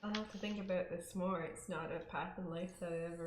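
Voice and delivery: faint, soft and distant; very quiet. Real, not acted. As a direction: speak barely above the mic. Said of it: "She's so freaking quiet".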